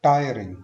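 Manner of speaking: Tiring with the first syllable टाय hit hard; first-syllable stress. Shown as a demonstration. Teacher modelling word stress, first syllable of tiring stressed. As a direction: punch टाय, let ring fall away. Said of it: "first syllable पे stress करना है".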